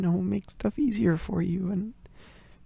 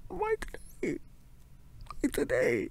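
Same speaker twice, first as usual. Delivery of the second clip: defeated mumble; 7 semitones higher, 4 dB quieter than usual. Acted. To her partner, repeating herself, defeated mumble. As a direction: let the question sink in despair. Same question repeated, sinking.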